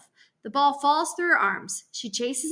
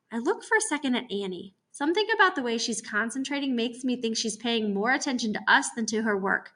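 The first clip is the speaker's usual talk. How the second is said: ordinary delivery, nothing marked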